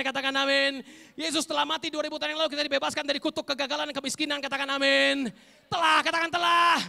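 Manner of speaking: shouted, high-pitched declarations; full-force preaching. Acted. Preacher declaring healing and demanding amens, shouted full-force preaching. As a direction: build to a roaring climax.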